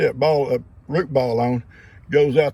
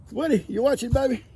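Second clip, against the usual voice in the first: higher, sing-song voice for the dog; pet talk; 8 semitones higher than usual. Real, not acted. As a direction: coo at your dog. Talking sweetly to his dog.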